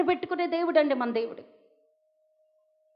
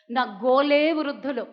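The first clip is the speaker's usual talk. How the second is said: normal talking voice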